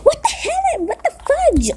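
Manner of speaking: very high startled cry; shocked outburst. Real, not acted. Player startled by the game, very high shocked cry. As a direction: shriek it in disbelief.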